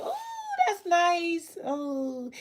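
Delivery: high, drawn-out mock admiration; put-on oohs of polite appreciation; imitated oohing admirers. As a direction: coo over it like a browsing customer. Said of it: "you get the oohs and the ahs".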